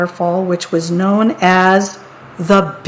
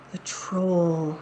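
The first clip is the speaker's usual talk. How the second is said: ordinary delivery, nothing marked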